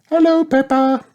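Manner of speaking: put-on very high little girl voice; Belinda Bear crying out. A narrator's reading. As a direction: greet a friend with delight. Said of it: "cried Belinda Bear".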